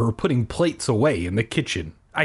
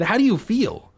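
worried mom; 8 semitones higher than usual. Acted. Mom asks son anxiously.

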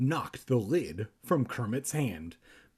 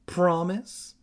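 Promise? with a pushing rise; demanding question; 5 semitones higher than usual. A narrator's reading. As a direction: press her for an answer. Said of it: "Evan demanded".

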